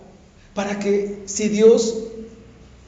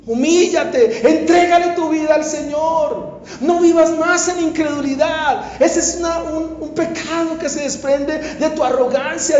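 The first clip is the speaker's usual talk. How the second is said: fiery preaching; 5 semitones higher, 4 dB louder than usual. Acted. Pastor urging the congregation to surrender to Christ, raised and fervent. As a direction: hammer each call to repent.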